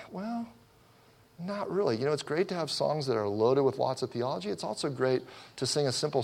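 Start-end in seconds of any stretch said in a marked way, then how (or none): 0.1-2.0 high, soft, reluctant objection; acted tentative reply, pitched way up; hesitant disagreement. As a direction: push back gently in a small high voice. His hesitant reply to critics.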